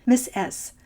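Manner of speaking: ordinary delivery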